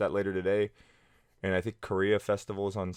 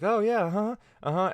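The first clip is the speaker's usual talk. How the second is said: chatty Chinese backchannel; 12 semitones higher than usual. Retold or imitated.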